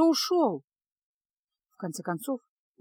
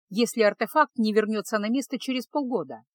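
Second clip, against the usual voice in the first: ordinary delivery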